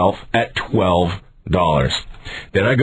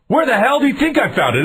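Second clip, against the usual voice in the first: high-pitched indignant retort; retold irritated comeback; exasperated retort; 14 semitones higher, faster than usual. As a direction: throw the question back, incredulous and higher. Acted quarrel with the clerk.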